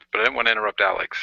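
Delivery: normal talking voice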